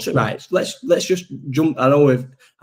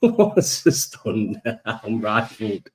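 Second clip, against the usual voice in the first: laughing; 3 semitones lower, much slower than usual. Real, not acted. Laughing recalling the tweet.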